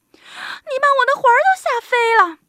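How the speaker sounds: high, loud startled squeal; old woman crying out. A narrator's reading. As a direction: shriek as a frightened little old lady. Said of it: "瘦小的老妇人喊起来".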